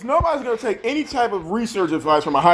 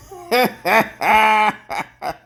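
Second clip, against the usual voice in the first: mocking laugh; 3 semitones higher than usual. Real, not acted. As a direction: laugh at her, high and mocking.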